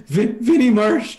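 high, stuttering attempt; scared stammer. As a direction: stutter the name out fearfully. Scared soldier repeats name wrongly.

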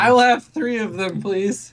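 put-on high-pitched drunken order; drunk crewmate. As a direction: butt in high and eager. Drunk [name] butting into call.

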